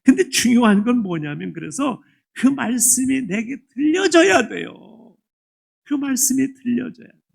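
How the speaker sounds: loud, driving sermon voice; fervent preaching. Acted. Pastor preaching loudly to the congregation, fervent. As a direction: press the point home to the congregation.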